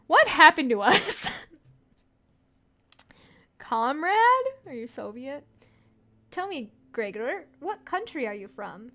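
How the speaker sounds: ordinary talk, nothing marked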